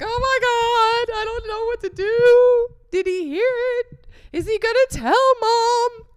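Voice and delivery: very high, loud drawn-out mock wail; hammed-up panic. Reading out her remembered diary entry, hammed-up panic. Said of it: "I had a diary entry about it and everything, like".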